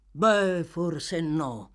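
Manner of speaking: grudging admission, falling away; reluctant. A narrator's reading. Sykes gives in to the girl, reluctantly. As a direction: concede it half-heartedly. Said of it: "rispose Sykes a malincuore".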